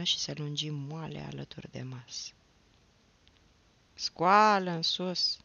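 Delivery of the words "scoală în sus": stern father's drawn-out order, louder; weighty, firm command; slow, forceful command. A narrator's reading. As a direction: order the boy up slowly, with weight. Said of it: "zise Moromete încet, dar cu glas îndesat".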